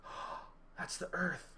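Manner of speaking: very soft, small realisation; hushed awe. Retold or imitated. Jumper looking down at the earth, hushed and awed. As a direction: drop from screaming to a stunned murmur. Stunned on looking down mid-scream.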